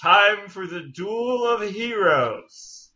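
slow, high, drawn-out proclamation; grand announcer. Pretend. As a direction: boom it out like a herald. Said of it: "I'm gonna be like".

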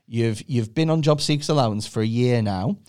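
low, measured official delivery; job centre adviser. Acted. Adviser telling a claimant about a job, low and measured. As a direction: break the news like a job adviser. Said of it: "going to the job centre, they were like".

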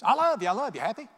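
loud, high, tossed-off "I love you"; curt, dismissive. Acted. Husband brushing off his upset wife, dismissive. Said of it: "how important tone is when you say something".

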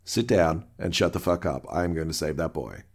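low, controlled acted authority; calm captain. Host as Picard silencing Remick, calm captain. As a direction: drop the pitch and answer firmly, unruffled.

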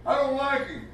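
high-pitched mock complaint, opening loud; petty complainer. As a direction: imitate someone griping to God about another believer. Preacher voices a judgmental complainer.

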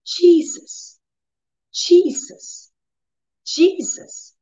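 high, wailing plea to Jesus; tearful crying out. Retold or imitated. In the bathroom, crying out high to Jesus. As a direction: cry out the name in pain. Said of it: "I started saying".

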